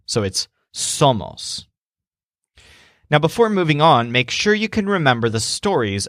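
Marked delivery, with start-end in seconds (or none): 0.7-1.7 "somos" said slowly, stressed on "som"; stress on first syllable. Shown as a demonstration. Teacher shows learners the word, first syllable of "somos" stressed. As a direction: lean on SOM in "somos", second syllable lighter. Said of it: "Remember to stress the syllable that is S O M".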